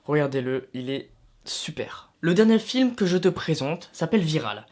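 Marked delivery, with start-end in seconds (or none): 0.7-2.1 whispering. Real, not acted. Confides a recommendation.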